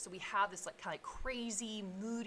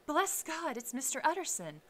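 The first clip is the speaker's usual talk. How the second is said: high-pitched cry of relief; 9 semitones higher, 5 dB louder than usual. A narrator's reading. Cook seeing Mister Utterson arrive, crying out. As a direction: call it out, pitched well up. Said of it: "crying out".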